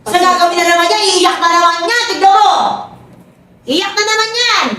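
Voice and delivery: high teasing sing-song; mocking prediction. Real, not acted. Friend mocking the dancer who will cry again, high teasing. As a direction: call it out like you saw it coming.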